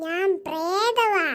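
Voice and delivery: slow, dragged ghost voice; the possessed girl answering in a slow ghostly voice. Acted. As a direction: drawl each word out like a spirit.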